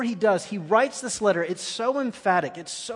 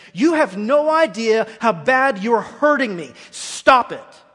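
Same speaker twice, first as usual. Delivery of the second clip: shouting in anguish, much louder and higher; retold scream; 5 semitones higher, 9 dB louder than usual. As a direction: yell it out in pain at the minister. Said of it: "I screamed at him".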